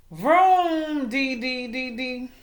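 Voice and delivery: fading engine noise; engine vroom fading. A narrator's reading. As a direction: rev then let it fade out. Engine noise sound effect.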